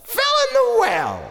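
very high, comic echo; high exclamation. Acted. Storyteller repeats the father's fall for children, high exclamation. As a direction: squeal it out in astonishment.

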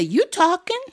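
lightly voiced high child's retort; cheeky child. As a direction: answer back in a high little voice. Child answering grandma back.

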